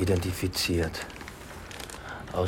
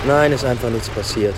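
raised voice; 5 semitones higher, 8 dB louder than usual. Acted. Terse reply to taxi driver.

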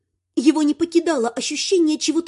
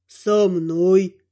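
deep, slow ghostly calm; low ghost voice; 7 semitones lower than usual. Acted. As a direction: speak like a calm old ghost. Ghost answers. (низкий голос призрака)

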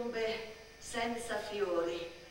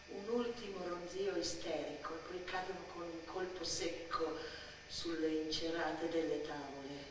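hushed narration; 2 semitones lower, 6 dB quieter than usual. A narrator's reading. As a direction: draw the listener into the drowsy stillness, softly. Hushed narration of a still afternoon. (narrazione sommessa e lenta)

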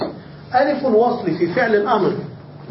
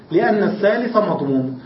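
ordinary delivery

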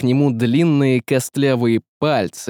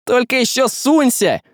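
high, angry warning cry; shouted threat; 12 semitones higher than usual. Acted. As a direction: shout a warning after a fleeing enemy. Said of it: "прокричал ей Василий".

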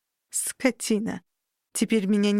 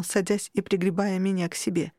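ordinary delivery

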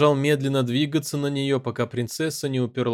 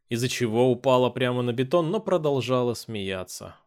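plain reading voice

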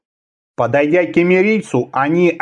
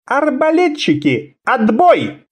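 high ringing command, sharp; sharp high order; 8 semitones higher than usual. Acted. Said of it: "резко приказал он".

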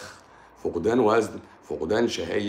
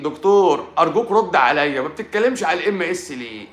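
pleading viewer; 8 semitones higher, 6 dB louder than usual. Retold or imitated. Commenter begging the doctor for an answer, high and loud. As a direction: whine it like a pushy commenter.

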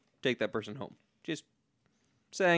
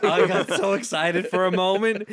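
laughing; 6 semitones higher, 6 dB louder than usual. Real, not acted. Laughing about hoped-for story.

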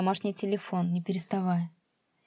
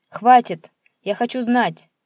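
loud higher shout of Хватит; angry shout; 4 semitones higher, 10 dB louder, slower than usual. Acted. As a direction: cut him off with a yell. Son cuts father off.

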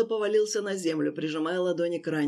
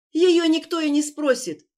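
desperate; 9 semitones higher, 6 dB louder than usual. Acted. Victim blurts out.

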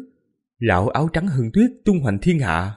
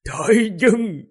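high, grieving murmur of a name; trembling murmur; 8 semitones higher than usual. A narrator's reading. Grieving king murmurs his dead beloved's name, trembling murmur. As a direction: murmur the lost one's name, pitched up. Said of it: "Thần vương lẩm bẩm, giọng run run".

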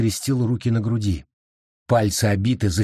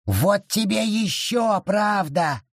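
high, slow shouted snarl; snarling creature; growled shout; 10 semitones higher, slower than usual. A narrator's reading. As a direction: hurl it at her. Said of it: "рычал лес".

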